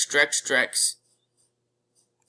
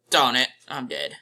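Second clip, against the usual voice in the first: ordinary talk, nothing marked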